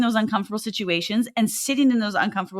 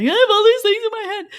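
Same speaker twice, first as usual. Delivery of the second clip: high, loud tearful burst; retold tearful cry; 12 semitones higher, 6 dB louder than usual. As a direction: blurt it out like bursting into tears. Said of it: "burst into tears and was like".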